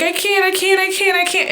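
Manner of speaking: high, strained panic, repeating it; panicked pleading. Retold or imitated. Retelling her first panic attack, high and panicked. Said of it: "just started crying".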